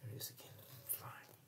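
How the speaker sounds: hushed, breathy whisper, just for the listener; whispered aside. Real, not acted. Reader whispers to the child at his side.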